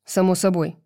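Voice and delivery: ordinary delivery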